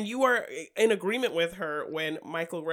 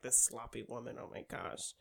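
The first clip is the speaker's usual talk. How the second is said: low, hushed disapproval; imitated disdain, dropping to a near whisper; muttered disdain; 7 semitones lower, 12 dB quieter than usual. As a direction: mutter it under your breath as he judges her. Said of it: "set up their disdain for each other".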